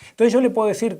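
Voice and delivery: normal talking voice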